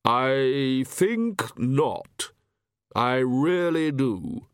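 very slow, hollow echoing voice; hollow Death voice. Acted. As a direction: drawl it like Death itself.

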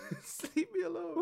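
high, whiny plea to be left alone; mock whine. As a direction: whine it like a pestered kid. Mock plea, much higher.

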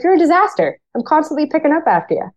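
wife mimics her husband's high, fast complaint; mock exasperated husband. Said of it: "My husband's like".

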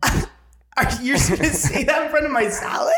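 laughing mock outrage. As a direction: act shocked while cracking up. Retold dream, confronting the boyfriend.

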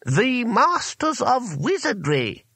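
high, slow, grand announcement; haughty master wizard, drawn out. A narrator's reading. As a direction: proclaim the title with lofty self-importance. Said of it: "said the voice haughtily".

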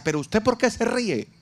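high-pitched mock-indignant question; indignant wife. Worried wife challenges her laughing husband, high indignant question.

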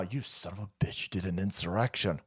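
usual speaking voice

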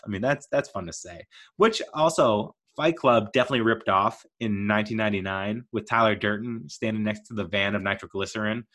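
normal talking voice

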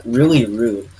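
ordinary delivery

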